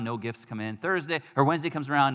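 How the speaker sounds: ordinary talk, nothing marked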